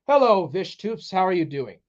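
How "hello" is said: ordinary delivery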